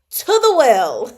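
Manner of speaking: loud, slow, drawn-out decree; mock banishment. Reader sentencing rude character to the well, mock outrage. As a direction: proclaim it high and loud.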